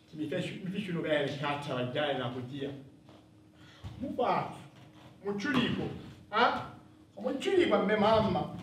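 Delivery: ordinary talk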